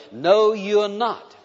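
slow, drawn-out emphatic no; firm denial. Acted. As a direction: stretch it out and shut the excuse down. Preacher contradicting an imagined excuse.

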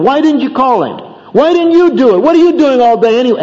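high, loud nagging wife; angry criticism. Acted. Upset wife scolding husband over insurance call, angry criticism. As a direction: berate him in rising exasperation, pitch way up. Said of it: "she criticizes him for something".

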